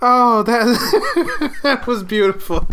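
high laughter through the words; can barely speak from laughing; laughing hard. Real, not acted. Laughing after the scene.

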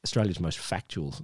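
low, dry ironic announcement; deadpan irony. Real, not acted. Host mocking their own show in a low dry tag. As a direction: sell the tagline dry and low.

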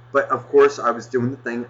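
ordinary talk

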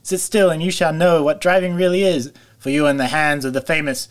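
triumphant boastful shout at full volume; reckless shout. A narrator's reading. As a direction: crow it out like a reckless braggart. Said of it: "he shouted recklessly".

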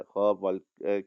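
ordinary talk, nothing marked

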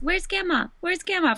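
put-on high-pitched little-kid question; high mock toddler voice; imitating toddler nephew. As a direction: mimic a toddler asking for grandma. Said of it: "he also says".

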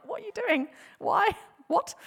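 high-pitched incredulous questioning; acted exasperation. Pharisees questioning guards who made no arrest, incredulous. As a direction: demand answers in mock disbelief.

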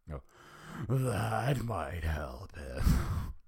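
slow, drawn-out sigh; sighing doctor. Acted. Fraser sighing to the gang, slow and drawn out. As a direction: sigh the words out very slowly. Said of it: "Fraser sighed".

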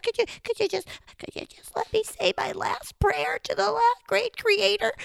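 high, stammering pleading; panicked Chipmunk. Acted. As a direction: gabble nervously as a cornered little critter.

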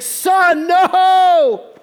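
high, loud anguished yell; a father crying out to his son on the tracks; a desperate shouted "no"; horrified scream. Retold or imitated. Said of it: "horrified from the booth".

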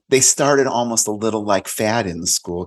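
usual speaking voice

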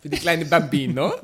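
loud laughing mock Italian; fake Italian accent. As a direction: ham up Bambino Italian style. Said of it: "Fake-Italienisch gesprochen".

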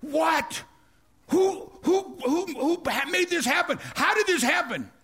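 high-pitched outraged disbelief; the king flipping out, shocked; enraged king demanding who did this. Retold or imitated. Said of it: "The king flipped out".

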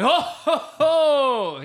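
loud, high Santa-like ho-ho-ho; hammed-up, drawn-out Santa laugh; booming belly laugh. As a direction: imitate a huge belly laugh. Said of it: "he has this incredible belly laugh".

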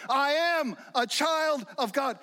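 high, slow mock shout; imitated kid's yell. Preacher replaying the kid's cry a little later, yelling. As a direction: call it out proudly like a little kid. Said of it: "who just screams it from the mountaintops".

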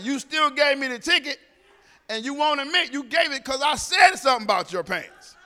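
loud, laughing retort; loud playful comeback to the officer; loud teasing. Retold or imitated. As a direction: tease her loudly between laughs.

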